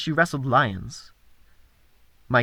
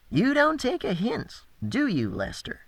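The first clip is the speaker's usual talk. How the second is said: menacing thug; 8 semitones higher, 5 dB quieter than usual. Acted. Thug Cade threatens Lester.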